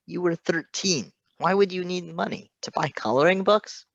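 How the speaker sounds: rising, incredulous teasing; mock incredulous. A narrator's reading. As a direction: needle him with disbelief. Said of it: "Bluebird, Patton scolded".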